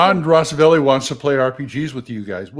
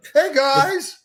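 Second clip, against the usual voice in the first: lightly raised, casual voice; his own higher voice; 8 semitones higher than usual. Shown as a demonstration. Radio voice man showing how he usually talks, a bit higher. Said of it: "I talk like this".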